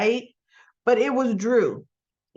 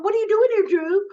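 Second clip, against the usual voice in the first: mock surprise; 10 semitones higher, 4 dB louder than usual. Voicing Carly surprised.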